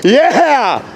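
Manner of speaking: high, exultant cheer; excited. Real, not acted. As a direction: cheer it out, thrilled. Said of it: "Sorry, I'm excited again".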